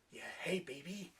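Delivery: lightly voiced lech, fading to a murmur; creepy cab driver. Cab driver hitting on the new girl, mock creepy. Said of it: "a creepy cab driver".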